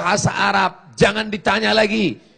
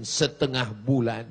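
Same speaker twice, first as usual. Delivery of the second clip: ordinary delivery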